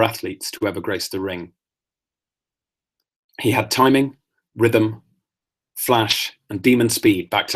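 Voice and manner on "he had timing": usual speaking voice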